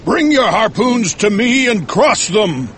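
high, commanding summons; called-out order. Acted. Ahab orders harpoons crossed, crying out. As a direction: command it across the deck. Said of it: "Ahab cried out".

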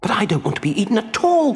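high, distressed puppet voice; crying out. Acted. Puppet protesting to the tuna about being eaten, high distressed cry. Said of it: "cried the puppet".

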